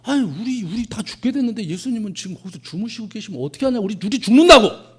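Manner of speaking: loud, high rising protest ending in a yell; exasperated outcry. Retold or imitated. Disciples protesting to sleeping Jesus, building to a yell.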